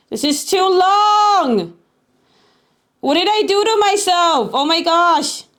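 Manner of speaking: high, drawn-out wail of complaint; high-pitched exasperated outburst; real exasperation. Real, not acted. Exasperated at endless TTS message.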